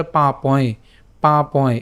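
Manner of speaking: পা পয় ending in a nasal glide; nasal sound. Shown as a demonstration. Teacher shows the nya sound, পা পয় nasalised at the end. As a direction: say পা পয় with the last sound through the nose. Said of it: "নাকে উচ্চারিত হবে".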